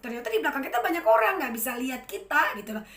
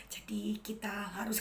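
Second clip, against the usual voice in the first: whispered flat demo of dull delivery; monotone mumble; 2 semitones lower, 8 dB quieter than usual. Shown as a demonstration. Lecturer demonstrating a monotone to students, soft flat whisper. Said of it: "kalau saya ngomong gitu".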